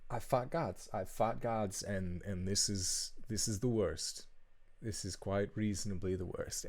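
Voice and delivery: a tired champion at his limit; weary Gideon. Acted.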